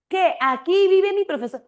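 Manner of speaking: a student caught off guard by the news; surprised student. Retold or imitated. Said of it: "una alumna".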